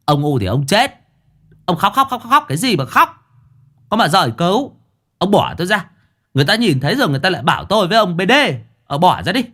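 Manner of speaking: exasperated shrieking scold. Acted. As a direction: shriek at him to let go. Master scolding crying servant.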